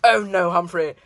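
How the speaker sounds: hammed-up posh English lady; mock upper-class toff; posh accent. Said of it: "the poshest way I've ever heard someone say".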